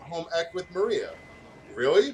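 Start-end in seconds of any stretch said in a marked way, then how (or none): 1.7-2.1 a surprised, much louder "Really?"; exclaiming. Acted. Maria, startled at her friend's class news, exclaims. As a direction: burst out in disbelief. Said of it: "Maria exclaimed".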